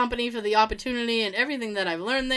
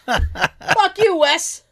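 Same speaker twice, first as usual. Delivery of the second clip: laughing; 7 semitones higher, 6 dB louder, much slower than usual. Real, not acted. Laughing taunt at old boss.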